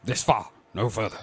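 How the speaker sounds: low, measured starship captain voice; Picard voice. Retold or imitated. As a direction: deliver it like a stern starship captain. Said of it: "it's your Picard voice".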